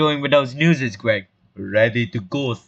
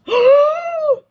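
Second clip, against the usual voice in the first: big, high crowd gasp; shocked gasp; 7 dB louder than usual. Acted. Townsfolk react to news from the library, shocked gasp. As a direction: gasp loudly in exaggerated shock. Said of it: "No gasping".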